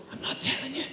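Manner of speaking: high secretive whisper, barely voiced; child's whisper. Child on the phone refuses to say who he is, whispering. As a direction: keep the secret, breathe it. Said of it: "the person answering the phone goes".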